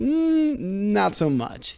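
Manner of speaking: slow, hammed-up hesitation; doubtful verdict. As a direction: stretch it out in mock reluctant second thoughts. Quoting his next-day doubtful verdict.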